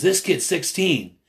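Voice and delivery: high, slightly slowed imitated insistence; retold urgent appeal. Man showing a teenager to protester, imitated urgency. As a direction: press the point as if quoting him. Retold confrontation over a kid.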